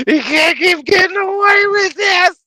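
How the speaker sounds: high, loud yelled movie quote; Jesse Pinkman quote. Retold or imitated. Trucker quoting Jesse to his co-host, high loud yell. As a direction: belt it out like Jesse. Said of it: "quote Jesse from Breaking Bad".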